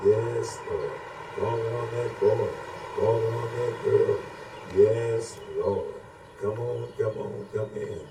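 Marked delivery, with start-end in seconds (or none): none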